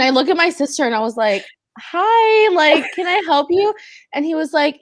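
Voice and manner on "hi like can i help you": high, bewildered hi to a stranger; confused politeness at the car window; puzzled. Retold or imitated. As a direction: caught off guard, asking what he wants. Puzzled greeting to gas attendant.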